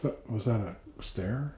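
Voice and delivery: tense slow whisper; whisper, straining to hear. Real, not acted. Ghost hunt, hearing noises.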